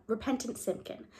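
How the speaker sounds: plain reading voice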